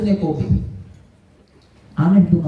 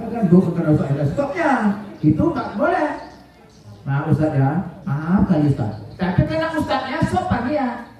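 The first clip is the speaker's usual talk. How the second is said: ordinary talk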